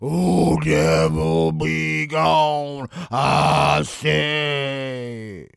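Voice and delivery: loud, ecstatic revival preacher; fervent exorcist preacher. Acted. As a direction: roar at the devil, full fervour. Exorcist ritual continues.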